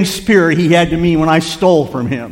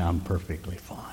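whispered, much lower and softer; 10 semitones lower, 16 dB quieter than usual. Retold or imitated. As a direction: breathe it out almost without voice. Quiet answer near death, whispered.

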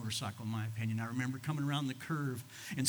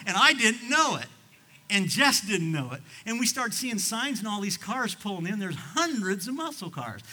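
excited; 7 semitones higher, 6 dB louder than usual. Real, not acted. Excited telling of car show.

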